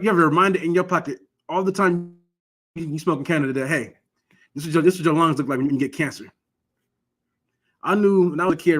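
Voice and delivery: ordinary delivery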